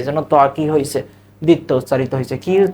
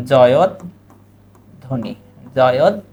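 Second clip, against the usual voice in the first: জয়ধ্বনি said very slowly, syllables pulled apart; slow correct pronunciation; 4 semitones lower, much slower than usual. Shown as a demonstration. Teacher giving the correct sound of জয়ধ্বনি, slow. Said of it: "জয়ধ্বনি শব্দটা সঠিক উচ্চারণ হচ্ছে".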